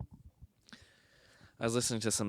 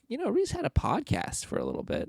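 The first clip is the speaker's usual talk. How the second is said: much higher voice of a funeral guest; mourner at funeral. Retold or imitated. At his funeral, a guest remembers him in a higher voice. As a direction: mimic someone recalling him fondly. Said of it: "someone's like".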